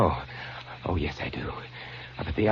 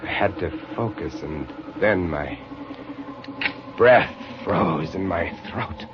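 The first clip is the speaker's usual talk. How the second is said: hushed dread; 8 semitones lower, 8 dB quieter than usual. A narrator's reading. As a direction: let the dread drain your voice. Narrator recalls frozen horror.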